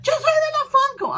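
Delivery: high loud Spanish shout; excited call. Retold or imitated. Fan telling a singer who she is, shouted high. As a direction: shout it so he recognizes you.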